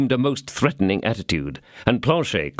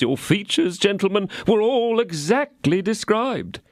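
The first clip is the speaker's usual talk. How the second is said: pleading innkeeper; 9 semitones higher than usual. A narrator's reading. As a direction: beg to be heard. Innkeeper explains.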